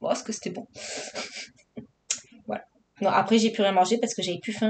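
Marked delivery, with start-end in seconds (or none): none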